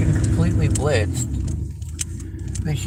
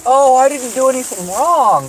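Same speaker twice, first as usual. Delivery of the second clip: higher, louder, mock-innocent put-on voice; mocking innocent whine; 8 semitones higher, 4 dB louder than usual. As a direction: sneer it like a fake-innocent woman. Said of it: "And then you say".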